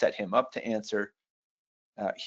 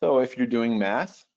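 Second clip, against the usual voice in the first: normal talking voice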